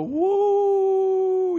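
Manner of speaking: high rising ballpark woo; excited fan whoop; crowd woo. Retold or imitated. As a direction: cheer the ball out of the park. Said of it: "watching the ball go".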